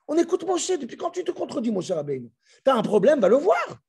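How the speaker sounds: high, sharp rebuke; voicing the crowd as it scolds Aaron; scolding. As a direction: scold him from above, pitch climbing.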